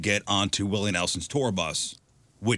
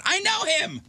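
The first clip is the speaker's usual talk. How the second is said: high-pitched re-enacted cry of recognition; excited shout. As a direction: call it out high, as if showing off. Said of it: "I shouted out".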